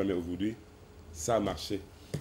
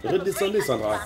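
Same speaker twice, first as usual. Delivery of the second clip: raised voice, high and loud; calling out; 9 semitones higher, 4 dB louder than usual. Real, not acted. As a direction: call her down firmly. Host calls her down urgently.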